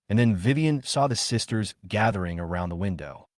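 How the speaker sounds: ordinary delivery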